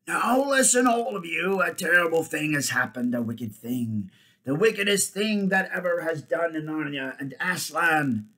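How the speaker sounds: high, loud ape proclamation. A narrator's reading. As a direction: raise the voice to address a whole crowd. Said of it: "began in a louder voice".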